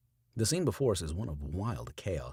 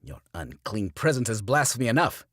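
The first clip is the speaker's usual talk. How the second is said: loud, higher screech; screeching preacher; 5 semitones higher, 8 dB louder than usual. A narrator's reading. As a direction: shriek the accusation. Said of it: "he screeches".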